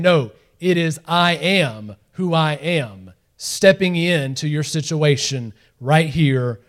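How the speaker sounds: slow, weighty, every word deliberate; solemn slow proclamation. Acted. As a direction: proclaim the divine name slowly. Preacher proclaiming God's name.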